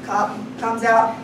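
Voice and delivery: ordinary talk, nothing marked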